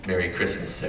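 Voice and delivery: low, hushed, confidential; leaning in, quiet. Retold or imitated. Flight attendant leaning in to the comic, low and hushed. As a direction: lean in and murmur it low. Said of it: "leans in and goes".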